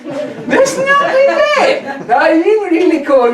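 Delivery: laughing. Real, not acted. Laughing at spooky picture eyes.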